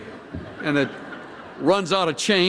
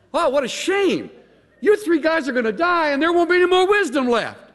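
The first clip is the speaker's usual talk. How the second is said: high, loud mock pity; mocking sarcasm; 14 semitones higher, 4 dB louder than usual. Preacher voicing Job mocking friends, high sarcastic jeer. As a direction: pour on fake sympathy.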